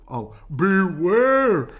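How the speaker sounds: loud, slow drawn-out warning; calling beware. A narrator's reading. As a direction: call it long and loud. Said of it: "called the bees to the bear".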